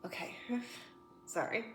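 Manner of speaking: quiet, recovering from tears; pulling herself together after crying. Real, not acted. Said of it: "I was not expecting to cry like that".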